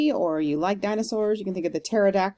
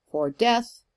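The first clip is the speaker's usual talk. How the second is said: "death" weightier than "for"; light "for", then an emphatic "death"; stress on DEATH; 4 semitones higher than usual. Shown as a demonstration. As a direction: land the beat on "death". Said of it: "emphasis on death".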